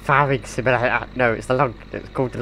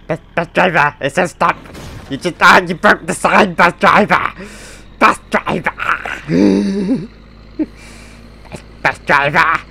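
loud, higher yelps at the crash; alarmed outcry; 5 semitones higher, 6 dB louder than usual. Pretend. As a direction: cry out as the sign goes down. Driver smashes the stop sign.

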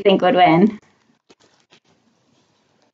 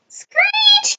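very high train-brake screech. A narrator's reading. Reader imitates the noisy train in library, high screech.

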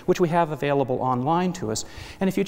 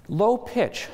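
normal talking voice

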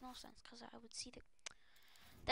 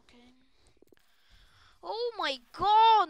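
ordinary delivery

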